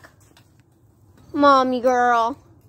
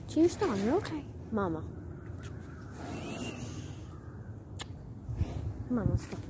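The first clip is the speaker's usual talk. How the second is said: soft, hushed coaxing; gently calm a frightened animal; 13 dB quieter than usual. Real, not acted. Soothing a scared horse.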